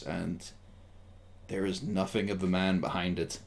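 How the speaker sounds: slow, hushed scene setting with long pauses; game master narration. A narrator's reading. Game master telling the player about the empty face, hushed narration.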